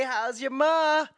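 high, slow greeting How's your ma; put-on voice. Greeting the viewers in a high put-on voice. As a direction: sing it out like a chirpy relative.

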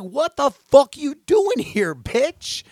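loud, high furious yell; retold yell. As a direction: scream it at the intruder in the yard. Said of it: "I started yelling at her".

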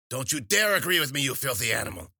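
loud, high witch's rebuke; mock-outraged witch, starting high; scolding the cat. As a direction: tell off the pet like a crone. Witch scolds her meowing cat.

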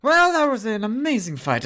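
a hint of a sultry demon praising the party; succubus voice. DM as succubus praising the party, lightly voiced. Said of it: "she looks at everybody and goes".

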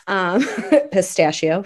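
"pistachio" said slowly the Italian way, with a laugh; Italian pronunciation. Shown as a demonstration. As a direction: say "pistachio" like an Italian, a bit slow. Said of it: "my Italian pronunciation".

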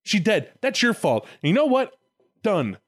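high, exasperated mock tirade; hammed-up indignant telling-off; scolding God. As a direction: rail at the culprit, pitched well up. Acted God scolding and cursing Adam.

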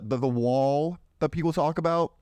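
ordinary talk, nothing marked

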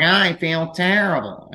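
hammed-up moaning roommate; mock complaining. Phil complaining after eating a bowl of cheese, mock whine. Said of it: "he'd be like".

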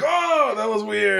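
startled viewer. Retold or imitated. Watching the alien clip, the viewer reacts startled. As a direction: react as if you just saw something uncanny. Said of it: "you're like".

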